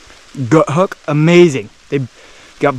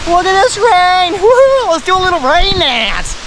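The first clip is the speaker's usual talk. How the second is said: excited; 12 semitones higher than usual. Real, not acted. Hiker cheering in rain.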